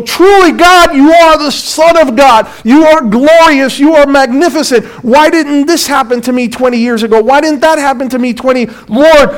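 high, loud cry of wonder; a new believer's awed cry. Retold or imitated. New believer crying out to God in awe. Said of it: "we go".

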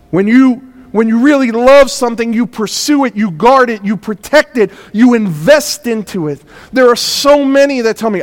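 loud, high, driving sermon delivery; full-force preaching. Acted. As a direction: preach it out to the whole church.

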